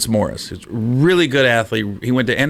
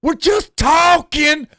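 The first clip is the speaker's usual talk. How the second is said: high, slow shrill-pitched protest yelled out; mock shouting girlfriend; slower than usual. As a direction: yell it down the street in protest. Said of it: "walking the streets going".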